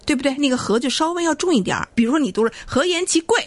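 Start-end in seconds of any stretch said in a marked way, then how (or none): 2.6-3.4 和颜其贵 read higher with the stress landing on 贵; wrong stress on 贵. Shown as a demonstration. Teacher showing a learner's wrong reading, 和颜其贵 with 贵 stressed and pitched high. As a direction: put the weight on 贵, not on 和. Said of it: "我怎么这把这个重音放到".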